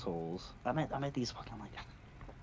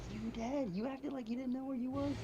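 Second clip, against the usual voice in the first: high teasing disbelief; high mocking disbelief; mock incredulous. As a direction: needle dad for playing dumb. Mocking dad's excuse.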